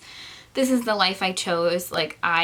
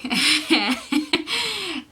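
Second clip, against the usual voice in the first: nervous laugh; 9 semitones higher than usual. Real, not acted. Nervous laugh about social media.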